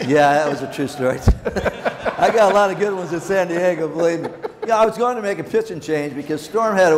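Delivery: chuckling through the words, pitch lifted; laughing while talking. Real, not acted. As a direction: tell it while still laughing. Laughs recalling San Diego story.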